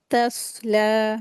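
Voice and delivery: तस्ला slow, with the स्वाद heavy and the ला drawn two beats; heavy saad. Shown as a demonstration. Teacher reciting तस्ला for students, heavy स्वाद. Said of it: "स्वाद को जो है heavy करके पढ़ेंगे".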